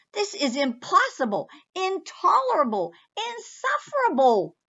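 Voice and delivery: building angry cry. Acted. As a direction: climb with each word in outrage. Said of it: "cried the bear".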